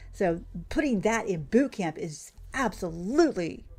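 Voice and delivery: ordinary delivery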